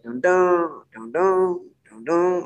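slow hummed guitar plucks; guitar imitation. Shown as a demonstration. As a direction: mimic the picked guitar notes slowly. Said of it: "one of them is just, like".

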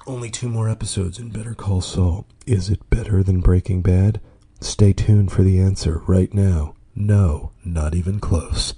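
announcer parody teasing the next answer; mock announcer. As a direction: boom it out like a trailer voice, slowing at the end. Fake promo for his show.

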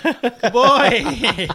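high, nervous dog-soothing voice with a laugh; nervous placating. Retold or imitated.

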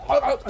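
high, strangled choking noises; imitated choking. Game master acting out Bizarro Alley choking on the cyber snake, gagging. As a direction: mimic gagging on something stuck. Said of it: "seems to be choking on it".

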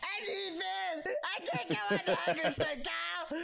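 Cartman voice. Pretend. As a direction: shout it like a whiny cartoon boy. Does Cartman voice.

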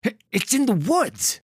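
high, loud, stammering fright; frightened victim pleading; trembling, confused. Acted. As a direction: blurt it out in panic. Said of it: "his voice trembling and confused".

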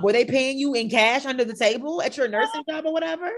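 high, loud disbelieving questioning; incredulous. Retold or imitated. Host challenges Erica about her nursing job, incredulous. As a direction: grill her as if the story is absurd.

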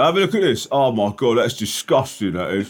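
high, hammed-up mock voice pushing a phone at someone; mock excited, high-pitched nagging; imitating Gratz's voice. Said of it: "while you're doing this voice".

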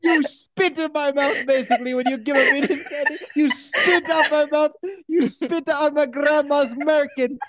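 high-pitched accented cartoon tirade; mock outraged rant. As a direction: rant in hammed-up offence, drawing out key words. Acted mock rant at the boy.

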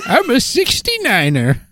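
put-on high old prospector voice; hammed-up gold rush miner. Prospector role, laughing.

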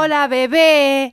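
high, loud, stretched put-on voice; mock sleazy greeting. Mocking how her ex greeted her, high and loud. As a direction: ham up a creepy flirt.

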